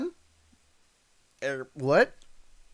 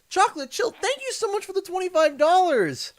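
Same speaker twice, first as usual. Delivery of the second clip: excited thanks; 4 dB louder than usual. Real, not acted. Hyped thanks to a donor.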